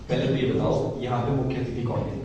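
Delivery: a hint of a judge quizzing a student; judge's question. Judge quizzing a nervous student, lightly voiced.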